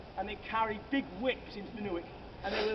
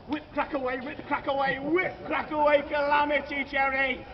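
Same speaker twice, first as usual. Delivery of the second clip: building frenzy; 5 semitones higher, 7 dB louder than usual. A narrator's reading. Building climax of the story.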